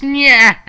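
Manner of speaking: very high, loud cartoon jeer; taunting nyah. Villain jeers at the waiting viewers, shrieking nyah. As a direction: stick your tongue out with a nyah.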